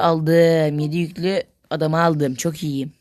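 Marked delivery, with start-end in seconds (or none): none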